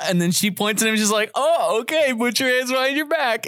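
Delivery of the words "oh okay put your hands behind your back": high retold arrest order; mock realization. Officer arresting the dancing driver, high-pitched mock realization. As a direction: imitate an officer suddenly catching on.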